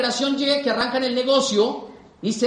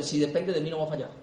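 más baja y suave, casi para sí mismo; voz interior; 7 semitones lower, 8 dB quieter than usual. Retold or imitated. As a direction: como la voz de la conciencia que responde. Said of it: "la voz te tiene que responder". (la voz interior responde a los escépticos, baja y suave)

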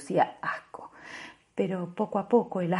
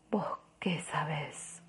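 very soft, slow unvoiced whisper; faint defiant whisper; 2 semitones lower, 13 dB quieter, slower than usual. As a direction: barely breathe it. Woman refusing, whispered reply.

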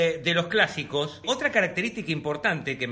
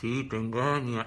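spirit voice; 8 semitones lower, 6 dB quieter, slower than usual. Retold or imitated. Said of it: "¿Qué contestaba?".

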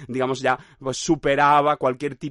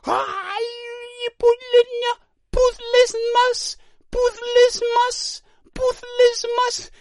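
very high, very slow, louder drawn-out clown wail; shaped scream; 3 dB louder, much slower than usual. Pretend. Legless man as ambulance arrives, very high slow wail. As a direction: wail it out long and high like a cartoon. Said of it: "hay una forma en esos gritos".

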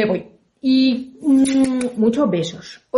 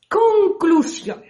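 Conclusión repeated higher and stretched out slowly; higher drawn-out repeat; 7 semitones higher, much slower than usual. Shown as a demonstration. Storyteller stressing the moral to her listeners, high slow Conclusión.

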